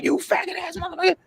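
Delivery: high mock outburst, held back in volume; squeezed, high replay of a furious yell; retold wilding out. Storyteller replaying his blowup at [name], high mock fury. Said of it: "I wild out like".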